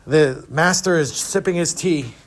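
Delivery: ordinary delivery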